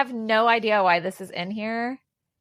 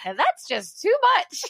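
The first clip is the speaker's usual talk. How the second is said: going full Southern; 8 semitones higher than usual. Retold or imitated. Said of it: "I'm, like, going full in it".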